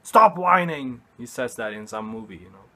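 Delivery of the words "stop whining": put-on loud Austrian-accented shout; Schwarzenegger impression. Quoting the action star from some movie, loud Austrian accent. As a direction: bark it in a thick Austrian action-hero accent. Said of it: "Arnold Schwarzenegger came to my mind. I thought about his accent".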